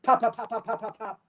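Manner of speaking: quick clipped pops like rapid shots; imitated gunfire. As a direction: rattle the shots off like a movie gun. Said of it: "like out of a fucking action movie".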